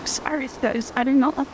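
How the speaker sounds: fighting back tears mid-sentence, then apologizing; getting emotional. Real, not acted. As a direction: voice choking up with real tears, pitch lifting. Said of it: "I'm getting so emotional".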